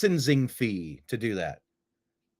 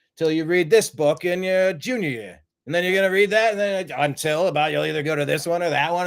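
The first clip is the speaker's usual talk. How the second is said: hammed-up Boston movie impression; amateur Matt Damon-style Boston accent; awful Boston accent; 2 semitones higher than usual. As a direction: do a badly faked Boston accent, rattling through the rant. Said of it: "That is an awful Boston accent".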